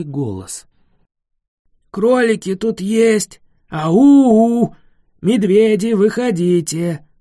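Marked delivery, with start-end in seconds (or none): 1.9-7.1 loud, high booming call; booming monster call. A narrator's reading. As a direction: bellow it out across the forest. Giant voice calling out loudly.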